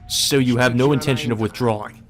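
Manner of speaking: ordinary delivery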